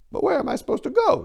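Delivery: lost and pleading through tears; croaked. Acted. Maria asking Enrico where to go, croaking. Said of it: "she croaked".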